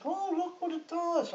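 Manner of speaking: high, drawn-out mock amazement; excited soldiers. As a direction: imitate soldiers marvelling at a new toy. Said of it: "they all start laughing".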